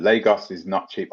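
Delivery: ordinary delivery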